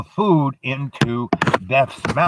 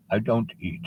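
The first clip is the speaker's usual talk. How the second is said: Death refusing; 7 dB quieter than usual. Acted. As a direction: turn the child down quietly. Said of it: "And he goes".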